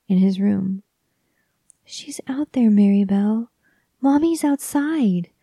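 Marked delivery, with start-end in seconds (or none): 1.8-5.3 put-on high, urgent child's cry; little boy crying to his sister about mother. A narrator's reading. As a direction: plead that she is out there, frantic. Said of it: "he would cry".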